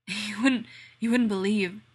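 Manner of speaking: breathless, loud panting words. Acted. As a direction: pant it out, gasping between words. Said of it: "Rodney pants".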